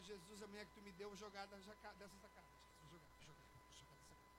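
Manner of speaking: very soft, low exasperated muttering; hushed comic grumbling; muttered mock prayer. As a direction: mutter a desperate prayer under your breath.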